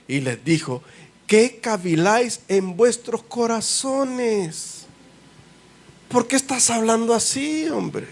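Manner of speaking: high, slow, fervent gospel reading; fervent preaching. Acted. Preacher reading to his congregation, fervent and slow. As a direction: lift it up like a preacher at the pulpit.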